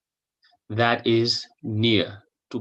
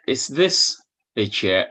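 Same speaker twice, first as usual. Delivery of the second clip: normal talking voice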